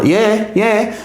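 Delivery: high, innocent little yeah; mock sheepish yeah. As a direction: play it innocent, light and high. Sheepish reply to the boss.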